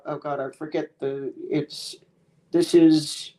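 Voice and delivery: ordinary talk, nothing marked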